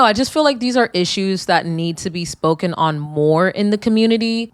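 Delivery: ordinary delivery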